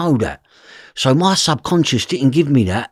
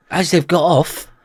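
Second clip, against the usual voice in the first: usual speaking voice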